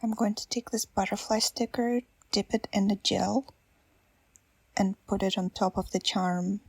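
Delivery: ordinary delivery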